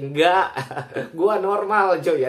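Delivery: loud laughing protest to a taunt; loud denial. Real, not acted. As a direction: push back loudly, half laughing.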